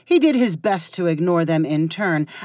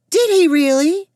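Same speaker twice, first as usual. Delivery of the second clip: disgusted; 11 semitones higher, 7 dB louder than usual. A narrator's reading. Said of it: "with a disgusted face".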